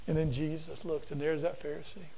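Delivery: ordinary delivery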